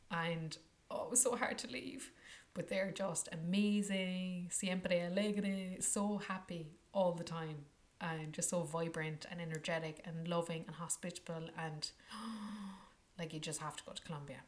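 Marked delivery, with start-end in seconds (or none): none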